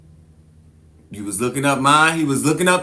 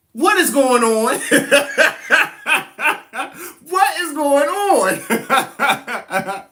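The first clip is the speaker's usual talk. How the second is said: high voice dissolving into laughter; cracking up mid-line, slowing right down; giggling through the put-on voice; laughing; 11 semitones higher, much slower than usual. Real, not acted. Breaks up laughing in the voice.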